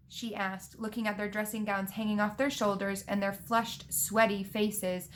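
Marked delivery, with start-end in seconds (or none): none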